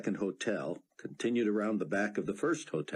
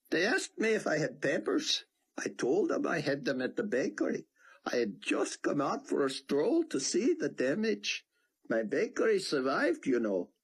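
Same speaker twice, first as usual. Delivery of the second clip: put-on higher old man's voice, amused and conspiratorial; Theon, gleeful rabbi; 9 semitones higher than usual. A narrator's reading. Theon recounts fooling officers to Buck, gleeful. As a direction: tell the tale like a delighted old prankster.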